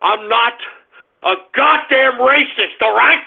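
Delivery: ordinary delivery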